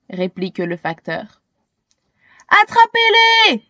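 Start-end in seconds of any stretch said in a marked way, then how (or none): 2.6-3.6 much higher, hunting cry; rally the crowd with a shout; sweeper shouting. A narrator's reading. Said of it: "crie le balayeur".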